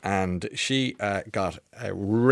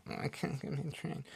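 whiny passenger; 6 semitones higher, 15 dB quieter than usual. Retold or imitated. Mocking the whining stranded passenger.

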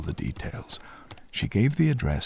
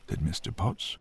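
ordinary delivery

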